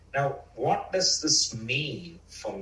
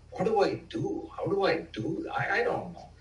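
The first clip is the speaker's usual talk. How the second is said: mock-bewildered, a little higher; lost and unsure; 6 semitones higher than usual. Himself, at a loss over the inner child, lightly bewildered. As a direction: ask yourself helplessly what to do.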